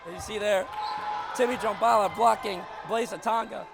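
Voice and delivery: loud, high play-by-play shout; excited call. Real, not acted. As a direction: sell the block like it's the game's biggest moment. Calling a block and score.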